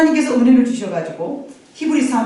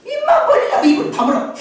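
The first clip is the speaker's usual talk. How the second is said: mock scolding mother; 4 dB louder than usual. Mother silencing baby, laughing.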